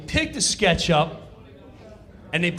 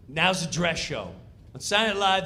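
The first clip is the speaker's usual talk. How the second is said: ordinary delivery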